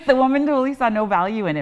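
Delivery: excited. Real, not acted. Still laughing about bargain.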